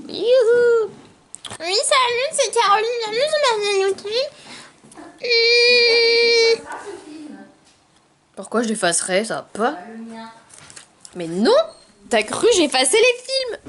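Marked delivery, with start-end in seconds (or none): 0.1-6.6 much higher, louder sing-song intro. Pretend. Mock intro, then hums. 12.1-13.6 high teasing voice. Real, not acted.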